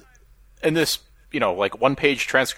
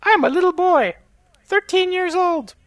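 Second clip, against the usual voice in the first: put-on much higher, slow little boy voice. Reading the chatbot boy's lines in a high child voice. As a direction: pitch it up like a small child. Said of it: "I wanna be the chatbot".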